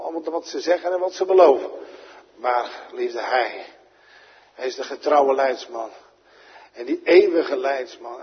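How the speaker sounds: ringing sermon climax; full-force preaching. Acted. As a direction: build the warning and proclaim. Warning then proclaiming.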